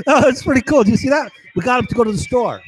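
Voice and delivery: excited mock glee. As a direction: crow with childish delight. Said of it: "they get all excited".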